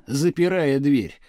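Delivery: ordinary delivery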